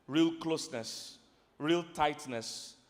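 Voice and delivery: drawn out slowly; slow and savoring. Real, not acted. Preacher describing closeness in marriage, slow and savoring. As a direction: linger on each word. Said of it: "build intimacy".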